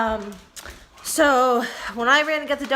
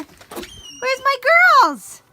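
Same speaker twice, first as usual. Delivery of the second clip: high sing-song call; 13 semitones higher, 7 dB louder, much slower than usual. Real, not acted. Calling for her dogs.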